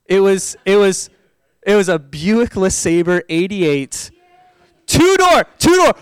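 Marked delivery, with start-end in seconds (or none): 4.9-5.9 high, loud, punched repeat; comic insistence; emphatic exclamation. Real, not acted. Stresses absurd car detail.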